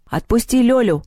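high, stern order; menacing demand. A narrator's reading. Girl ordering the beaten eagle, menacing. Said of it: "грозно сказала Маша".

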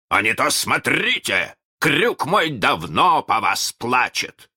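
высоко, с угрозой; пригрози пиратам капитанским окриком; угрожающий крик. A narrator's reading. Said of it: "кричал Питер". (грозный окрик)

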